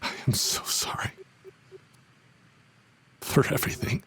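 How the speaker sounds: soft breathy whisper, barely voiced; whispered apology. Mentor apologizing to his student, whispered. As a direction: confess it under your breath.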